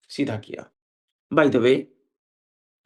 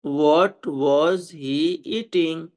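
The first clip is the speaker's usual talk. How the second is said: very slow, word by word; measured example for learners to follow; slow model sentence; much slower than usual. Shown as a demonstration. Teacher models translation slowly. (बहुत धीरे, एक-एक शब्द)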